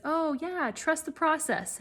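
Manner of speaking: mock coach reply, pitched high; breezy coach. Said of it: "And then the coach says".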